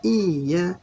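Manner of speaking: ordinary talk, nothing marked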